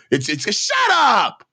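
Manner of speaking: yelling at the screen in frustration; frustrated shout. Real, not acted. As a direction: cut him off with a real angry shout. Yelling at the TV clip.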